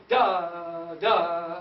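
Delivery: loud held DA with a breath push; DA as a big accented note; accented D attack. Shown as a demonstration. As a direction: push each DA out strongly on the air. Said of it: "put a D in front of it".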